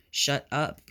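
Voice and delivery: talking through a mouthful of rice; chewing rice mid-sentence; mouth full. A narrator's reading. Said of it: "through a mouthful of rice".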